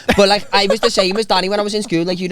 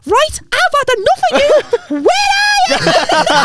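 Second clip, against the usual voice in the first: put-on squeaky tough kid voice; 4 dB louder, slower than usual. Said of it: "he rang me and I went".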